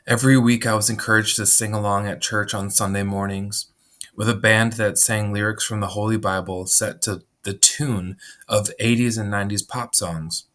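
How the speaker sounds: ordinary talk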